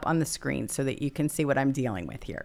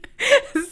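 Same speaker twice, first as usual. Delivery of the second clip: high burst of laughter; 7 dB louder than usual. Real, not acted. Laughing at own dog story.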